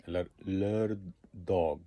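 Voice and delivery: লারদগ drawn out slowly, syllable by syllable; slow careful demo. Shown as a demonstration. Teacher to learners, sounding out লারদগ very slowly. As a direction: stretch লার- then লারদগ very slowly. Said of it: "যেভাবেই বলেন".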